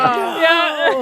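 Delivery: imitated group reaction. Friend imitating everyone's reaction to the scene, mock dismay. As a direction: act out an audience groaning in dismay. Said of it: "everyone was like".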